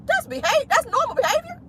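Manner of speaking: high-pitched incredulous question; softly squealed, rhetorical disbelief; mock disbelief. Incredulous after imitating the child's scream.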